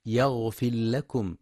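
يغفر لكم with the ra swallowed into the lam; wrong merged R. Shown as a demonstration. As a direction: run يغفر into لكم as "yaghfil-lakum". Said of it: "بإدغام الراء في اللام".

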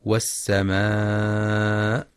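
long held aa in والسماء before the hamza; والسماء with a drawn-out, level final aa; connected madd. Shown as a demonstration. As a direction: stretch the last aa of والسماء four or five counts. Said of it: "للمد المتصل الواجب".

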